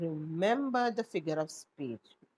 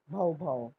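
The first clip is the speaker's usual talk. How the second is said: dog bark; 4 semitones lower than usual. Shown as a demonstration. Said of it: "How does a dog bark?".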